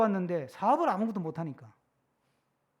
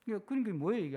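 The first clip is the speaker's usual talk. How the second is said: usual speaking voice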